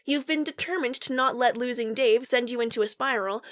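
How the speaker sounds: ordinary talk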